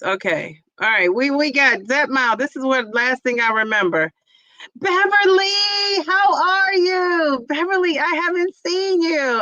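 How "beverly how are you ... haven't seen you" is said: excited greeting. Real, not acted. Greeting a chat viewer warmly.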